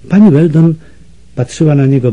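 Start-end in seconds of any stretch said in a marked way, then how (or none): none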